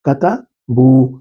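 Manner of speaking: کتبو stretched very slowly as ka-ta-buu; drawn-out Arabic form. Shown as a demonstration. Teacher showing students the plural form, drawn out very slowly. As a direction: draw out every syllable of کتبو, katabuu. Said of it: "آپ کو نظر آ رہا ہے نا".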